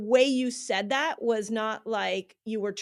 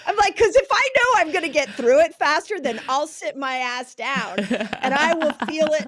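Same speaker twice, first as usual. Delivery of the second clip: louder and higher, laughing through it; 5 semitones higher, 6 dB louder than usual. Real, not acted. Answering her co-host's teasing, laughing loudly. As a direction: laugh out the excuse for being ambitious. Laughing at being called ambitious.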